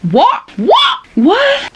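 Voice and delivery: incredulous yelps leaping very high; shocked squeaks. Real, not acted. Reader stunned by the page, shrieky incredulous yelps. Shocked by what she reads.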